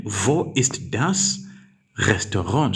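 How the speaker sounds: "Wo ist das" very slow, rising; slow question. Shown as a demonstration. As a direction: read the question slowly, stressing "Wo". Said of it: "that's now a question".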